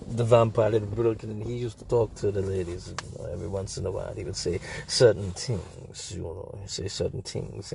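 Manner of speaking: put-on low, soft Transylvanian-Russian vampire accent. Explaining how the vampire talked to ladies, in a soft Transylvanian accent. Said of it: "I don't know which accent this is that I'm doing".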